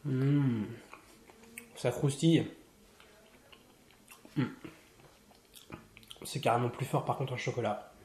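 chewing while talking; speaking between bites; mouth full. Real, not acted. Seen 1: bites bread at -3.0, mouth working and holding piece throughout.

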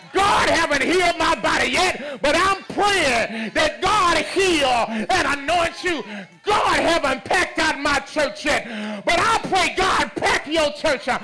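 loud, slow, building preacher cry; loud sermon climax, slowed and pounding; full-force preaching. Acted. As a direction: drive each line home to the congregation.

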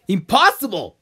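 high, loud burst of disbelief; emphatic exclamation. Acted. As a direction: exclaim it loudly, pitch leaping up. Exclaims in acted bit.

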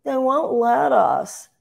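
high, very slow whiny mock quote; whining child. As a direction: whine it like a helpless kid. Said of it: "to hear Democrats go".

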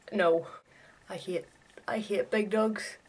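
usual speaking voice

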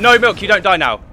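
high, loud urgent yell; loud shout. Real, not acted. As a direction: shout at the teammate to survive. Teammate about to die.

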